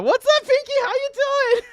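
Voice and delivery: very high squeaky falsetto; playful put-on tiny high voice; silly squeaky greeting. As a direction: greet them in a goofy squeal. Playful greeting to arriving viewer.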